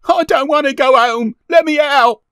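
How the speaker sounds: put-on very high, loud child's protest; frantic boy yelling high; crying out. A narrator's reading. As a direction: wail in panic to be let go. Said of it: "he cried".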